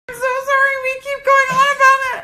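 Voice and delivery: high, fast giggling apology; giggly high-pitched sorry; laughing apology. Real, not acted. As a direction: squeak it out through laughter.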